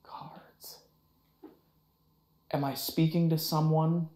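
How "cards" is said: whispering. Real, not acted.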